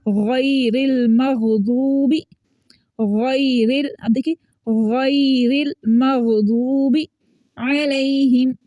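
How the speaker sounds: गैरिल with thick throaty gh, "ghayril"; slow, held गैरिल मग़दूबी with full gh; heavy ghain recitation. Shown as a demonstration. Teacher reciting Surah Fatiha as example, heavy ghain. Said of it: "गैन को मोटा ही पढ़ रहे हैं".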